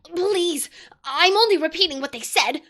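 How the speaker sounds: put-on a boy stammering to save himself; stammered. A narrator's reading. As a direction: Edmund stammers before the furious queen. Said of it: "stammered Edmund".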